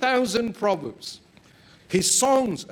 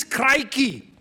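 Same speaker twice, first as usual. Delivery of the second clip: a sudden loud, high yelp of shock; jolted awake and blurting it out; startled exclamation; 5 semitones higher, 5 dB louder than usual. Acted. Spy drenched awake at three, blurting a loud startled cry.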